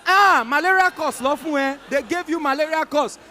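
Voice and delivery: mother celebrating wrongly; overjoyed mother ignoring her son's correction; excited mother. Retold or imitated.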